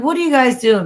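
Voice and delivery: high, loud call up into the trees; retold call up into the woods. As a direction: shout up to them, curious. Calling up to figures in tree.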